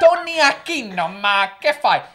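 light Italian-American flourish; mock Italian greeting. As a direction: call out like a mock Italian uncle.

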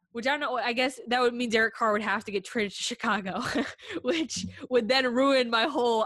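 ordinary talk

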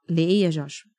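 low retold paternal command; firm father. Father telling crying son to read, firm. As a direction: order it firmly, pitched well down.

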